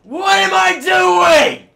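very loud, high, slow outrage; screamed rage. Real, not acted. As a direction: scream it at the top of your voice. Gamer fails again.

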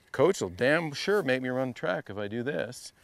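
ordinary talk, nothing marked

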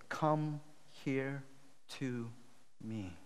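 slow, soft, word by word; calm summons. Acted. Elijah calling all the people to him, quiet and deliberate. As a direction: beckon quietly with pauses between words.

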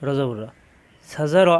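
ordinary talk, nothing marked

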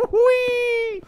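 very high, loud whee; playful whoop. Pretend. Streamer zooming about in the game, high playful whoop. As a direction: squeal it out long and high.

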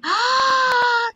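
long high, loud gasp; shocked gasp. Real, not acted. Streamer reacting to everything getting messy, shocked gasp. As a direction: gasp shrilly at a sudden setback. Mishap in the race.

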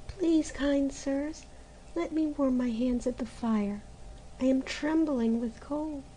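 put-on freezing girl begging by the fire; cold pleading. A narrator's reading. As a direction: beg meekly through the cold. Freezing girl begs the months.

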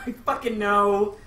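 loud, slow frustrated insistence; exasperated. Real, not acted. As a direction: snap back in mock annoyance. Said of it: "I know that".

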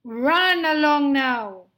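loud, indulgent dismissal; booming lion. Acted. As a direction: send the mouse off loudly. Lion releases mouse, calling loudly.

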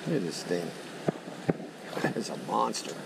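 ordinary delivery

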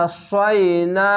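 আসাইনা slowly, long vowels held; drawn-out recitation. Shown as a demonstration. Teacher showing learners how to hold the alif, drawn out. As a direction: stretch আসাইনা out in measured recitation. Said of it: "এক আলিফ টেনে পড়বেন".

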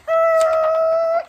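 excited. Acted. Delighted at race car.

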